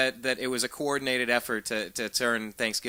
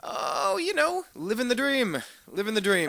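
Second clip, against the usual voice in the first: ironic cheer; 12 semitones higher, 5 dB louder than usual. Acted. Sarcastic cheer on the phone.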